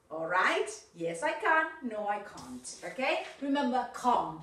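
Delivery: ordinary delivery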